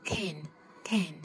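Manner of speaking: American can with the a leaning toward e, said lightly. Shown as a demonstration. Teacher showing learners the American can. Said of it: "Người Mỹ".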